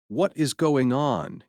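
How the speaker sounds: usual speaking voice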